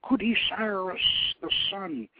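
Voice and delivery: plain reading voice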